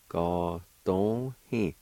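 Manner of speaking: Ga dong hi said slowly with every syllable clear; slow demo. Shown as a demonstration. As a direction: drag out Ga dong hi so learners can follow.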